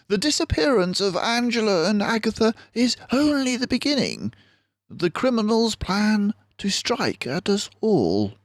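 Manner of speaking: high, quick, urgent sleuth voice; a keen little detective sounding the alarm; Basil the mouse detective. Acted. Basil warns the doctor of the plot, high and urgent.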